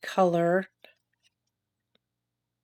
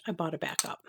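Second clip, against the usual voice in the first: a guilty aside, whispered and softer; confiding whisper; 3 semitones lower, 9 dB quieter than usual. Real, not acted. To viewers, admitting she bought a second one: whisper. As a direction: whisper it like a little confession. Confessing an extra purchase.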